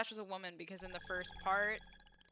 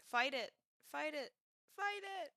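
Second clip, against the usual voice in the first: each fight it climbs higher in pitch; rising urgent plea; 7 semitones higher than usual. Acted. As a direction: push harder each time, pitch climbing. Urging character to resist.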